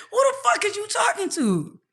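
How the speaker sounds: high, sharp, indignant question; indignant outburst. Retold or imitated. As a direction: fire it back, offended. Outraged retort.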